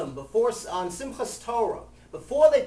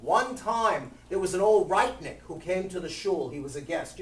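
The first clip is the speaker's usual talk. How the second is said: ordinary talk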